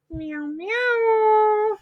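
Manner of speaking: high playful meowing like a kitty; cheerful kitty meows; cat meows. Pretend. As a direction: mew like a friendly cat. Cat says hello again.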